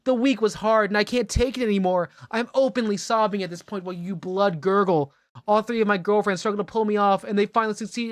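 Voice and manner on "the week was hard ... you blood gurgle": high, heated mock rant; hammed-up furious yelling. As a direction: build to an overwrought breaking point. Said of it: "the yells get louder".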